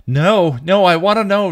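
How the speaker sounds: loud, very high protest; arguing. A narrator's reading. Dawn pulls against Buffy's grip, arguing. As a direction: wail it in furious insistence. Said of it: "Dawn argued".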